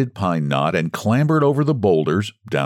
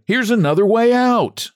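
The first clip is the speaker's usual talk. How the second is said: excited; 3 dB louder than usual. Acted. Discovers the light ahead.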